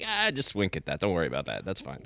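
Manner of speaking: mock league official murmuring it aside; a sly, dropping-away mutter; hushed brush-off. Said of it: "they're like".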